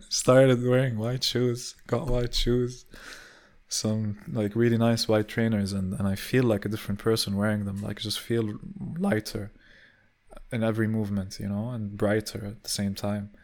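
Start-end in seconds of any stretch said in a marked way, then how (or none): none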